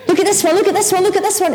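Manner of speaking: high, urgent re-enacted excitement; excited spotting. Excitedly spotting her husband.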